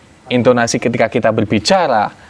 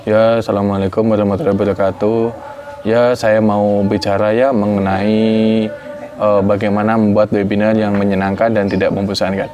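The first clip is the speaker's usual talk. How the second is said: lower, monotone dull opening; flat boring speaker; 6 semitones lower than usual. Shown as a demonstration. Mocking a boring webinar host's flat greeting. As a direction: sound like a dull presenter. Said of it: "Daripada kita biasa-biasa aja".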